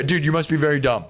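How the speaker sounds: ordinary talk, nothing marked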